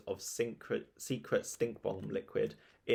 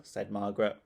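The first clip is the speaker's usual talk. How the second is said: plain reading voice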